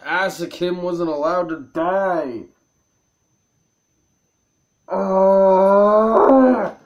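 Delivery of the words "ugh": long exasperated groan; drawn-out growing groan of frustration; frustrated groan. Real, not acted. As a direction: groan hard at your own mistake. Player realises the mistake.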